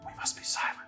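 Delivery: soft secretive whisper. Acted. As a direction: breathe it without voice. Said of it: "she looks, and she goes".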